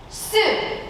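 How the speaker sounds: ordinary delivery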